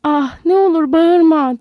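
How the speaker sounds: tearful pleading. A narrator's reading. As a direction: beg through rising fear, drawn out. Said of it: "Lenny korkudan ağlamaya başladı".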